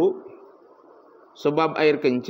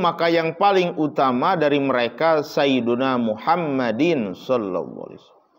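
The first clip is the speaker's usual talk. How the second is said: ordinary talk, nothing marked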